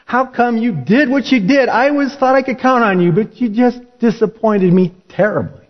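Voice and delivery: the master pretending to rebuke his disciple, mock scolding high. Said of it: "he didn't chew him out".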